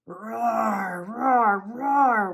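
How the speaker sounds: big, loud, rising roars; lion roaring. A narrator's reading. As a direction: roar like a storybook lion. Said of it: "went the lions".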